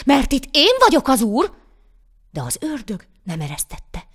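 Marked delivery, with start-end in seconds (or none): 0.1-1.5 loud, high assertion of authority; defiant shout. A narrator's reading. Poor man to devil in own yard, defiant shout. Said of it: "kiáltott a szegény ember".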